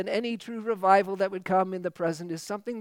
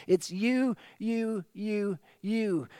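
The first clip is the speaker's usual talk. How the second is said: each you hammered, then dropping to a hush; insistent repetition; 3 dB quieter, much slower than usual. Shown as a demonstration. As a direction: point at God with every you. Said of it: "it's God who is doing it".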